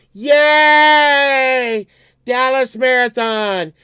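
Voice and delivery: high, loud, long whoop; mock cheering. Marathon crowd in the middle of the street, mock cheering. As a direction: cheer like an overjoyed race fan. Mimics people walking in the street.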